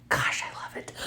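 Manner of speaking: hushed, delighted whisper. Real, not acted. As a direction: breathe it out in admiration. Admiring the finished beads.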